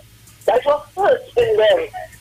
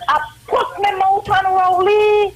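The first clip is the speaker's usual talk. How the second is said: higher, hammed-up bawling, slow; mock wailing; 8 semitones higher, slower than usual. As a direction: imitate a woman wailing over the election loss. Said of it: "and balling both arms".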